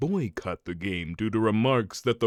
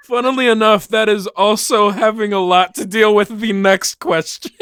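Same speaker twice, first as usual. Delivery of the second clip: laughing; 8 semitones higher, 11 dB louder than usual. Real, not acted. Laughing, loud after joke.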